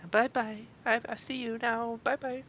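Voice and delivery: very high playful bye-bye; teasing singsong. Retold or imitated. Vlogger mocking the vanishing flavor, high teasing goodbye. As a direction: wave it off in a high taunting voice.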